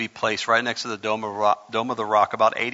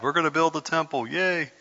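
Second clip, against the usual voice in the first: higher, quick sing-out of fake glee; mock excitement; 5 semitones higher than usual. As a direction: imitate an excited crowd, lightly mocking. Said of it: "Everybody's real excited".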